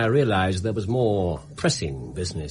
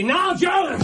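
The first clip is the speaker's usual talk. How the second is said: shout. Acted. Holmes springs the trap.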